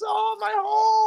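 high, drawn-out mock cry of pain; Nia Jax wail. Wrestler landing hard on the ring edge, pained. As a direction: wail it slowly in comic agony. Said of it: "now you got Nia Jax".

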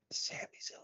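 hushed whisper, rising at the end. Retold or imitated. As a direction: whisper it behind his back. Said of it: "I'm like".

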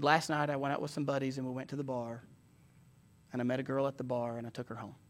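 low, hushed retold confession; subdued confession. Young man confessing to his pastor by phone, subdued. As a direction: confess it softly, voice dropped. Retelling a caller's tearful confession.

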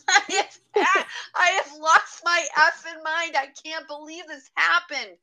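high, loud, half-laughing wail; retold hysterical outburst. Repeating the shock to friend Julie, hysterical laughing outburst. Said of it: "we're sitting there bawling our eyes".

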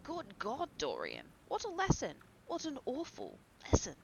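loud, high, anguished exclamation; wildly pitched lament; horrified outcry. Acted. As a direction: cry out aghast, swooping high. Basil horrified, cries out.

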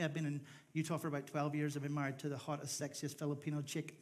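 usual speaking voice

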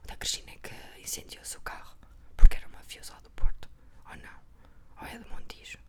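hushed gossip whisper. Real, not acted. Unsure about the burned car story, whispering the gossip. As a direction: murmur the rumour under your breath. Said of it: "mas vou falar baixinho".

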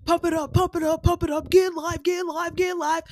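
high rhythmic chant ending in a long woo; hype man in her head. Retold or imitated. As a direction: fire yourself up like the MC. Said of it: "I hear that hype man".

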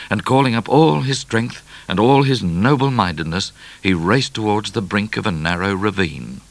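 usual speaking voice

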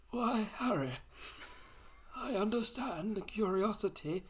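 a put-on elderly Dumbledore; old man's voice. Shown as a demonstration. Dumbledore answering Harry in old man's voice. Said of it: "put on an old man's voice".

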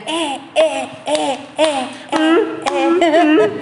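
vítores infantiles imitados, más agudos al final; coro de niños animando. Acted. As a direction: imita a los niños jaleando, con risa. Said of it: "escuché aplausos que decían". (porras de niños imitadas entre risas)

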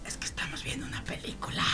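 hushed whisper while hiding; hiding under the bed, whispering to mom on the phone. Retold or imitated. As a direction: whisper so nobody hears you. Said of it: "¿por qué hablas así?".

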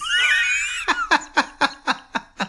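long, very high squealing laugh; squealing laughing fit; helpless laughter. Real, not acted. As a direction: dissolve into high-pitched laughter. Cracks up after his own quote.

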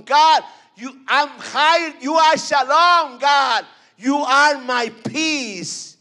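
high, loud preacher's cry building to a shout; preaching at full force. Acted. Preacher crying out praise to God before the church, loud.

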